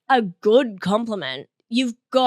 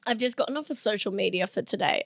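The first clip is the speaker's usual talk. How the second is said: normal talking voice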